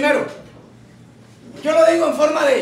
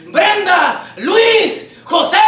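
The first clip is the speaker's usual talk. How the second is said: high-pitched name-by-name holler; calling out shouting; 4 semitones higher, 4 dB louder, much slower than usual. Acted. Someone yelling at people who ignore them, calling out. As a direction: shout each name to get their attention. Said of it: "si alguien te está gritando".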